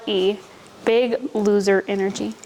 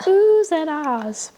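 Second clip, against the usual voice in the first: drawn out; 5 semitones higher, much slower than usual. Real, not acted. Playful drawn-out crowd sound.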